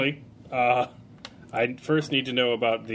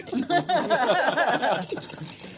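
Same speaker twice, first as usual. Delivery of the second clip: high laugh; 14 semitones higher than usual. Real, not acted. Player bursts out laughing.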